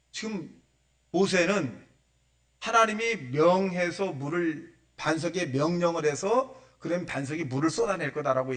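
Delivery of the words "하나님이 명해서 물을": ordinary talk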